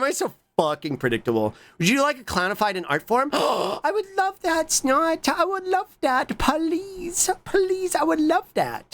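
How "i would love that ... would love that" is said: very high, drawn-out begging; hammed-up squeal of delight, pleading; gushing plea. Streamer begging chat for clownified art, hammed-up high gushing.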